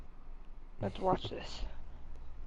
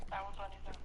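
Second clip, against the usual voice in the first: said again much softer, testing the mic; quieter mic test; 12 dB quieter, faster than usual. Shown as a demonstration. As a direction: repeat it softly to check your own sound. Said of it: "Wait, can I hear myself?".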